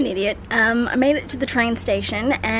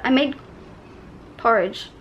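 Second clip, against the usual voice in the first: ordinary delivery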